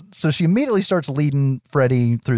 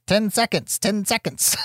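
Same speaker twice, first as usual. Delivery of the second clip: higher, robotic countdown voice, softly; talking watch; 8 semitones higher, 4 dB quieter than usual. Retold or imitated. The heroine's talking watch warning her, robotic countdown. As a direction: mimic a little machine counting down. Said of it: "starts saying".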